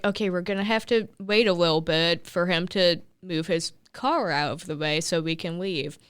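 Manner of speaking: normal talking voice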